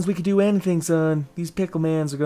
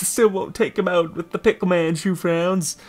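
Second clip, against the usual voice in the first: ordinary talk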